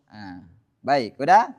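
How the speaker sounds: ordinary talk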